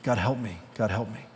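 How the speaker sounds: soft, falling-away whimpered plea; whimpering. Acted. Ghost begging God as the lizard dies, whimpering softly.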